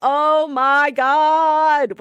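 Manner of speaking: dragged out in mock horror; can't believe you haven't seen it; stunned disbelief. Real, not acted. Friend hasn't seen The Punisher.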